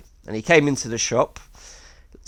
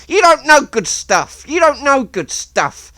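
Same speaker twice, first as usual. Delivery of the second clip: much higher, louder indignant insistence; offended Miles; 12 semitones higher, 6 dB louder than usual. Retold or imitated. Miles insulted that Jeff refuses, much higher and louder. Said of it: "And he was like".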